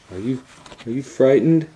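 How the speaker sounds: put-on high, loud little-girl voice; little sister asking brother if he is scared, high and loud; frightened little girl. A narrator's reading. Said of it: "said Gretel".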